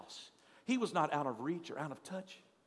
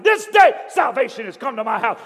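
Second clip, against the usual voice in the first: loud, high triumphant shout; running through the street shouting for joy; 5 semitones higher, 18 dB louder than usual. A narrator's reading. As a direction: proclaim it at the top of your lungs. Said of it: "They could hear him shouting".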